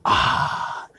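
refreshed ah. Real, not acted. Refreshed sigh after a sip.

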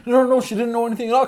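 fast, high hurried mock protest; flustered denial. Host mimics Archie and Veronica protesting Betty's innocence, fast and high. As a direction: rush the no-no-nos up high like panicked teens.

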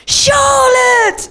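put-on high, loud English-accented call; terrible English accent. [name] hailing her across the room, bad English accent. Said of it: "A terrible accent".

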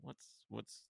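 low, hushed retold inner thought; quietly puzzled thinking aloud; uneasy musing. Retelling his own uneasy inner thought.

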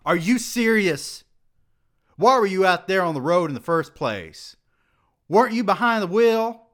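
high, loud furious questions; angry scolding. A narrator's reading. Dean berating the student over the drive, furious. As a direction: tear into him.

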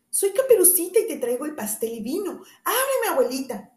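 high, sweet little-girl voice, put on by the wolf; the wolf poses as the girl to get the door opened; wolf faking a girl's voice. The wolf fakes a sweet high girl's voice at grandmother's door.

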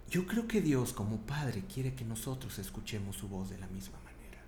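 ordinary talk

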